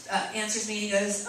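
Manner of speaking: ordinary delivery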